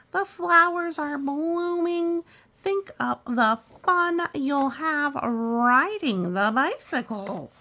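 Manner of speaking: high, loud and slow, coaxing; cheerful Peg. Acted. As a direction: cheer up a worried friend. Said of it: "cried, called Peg".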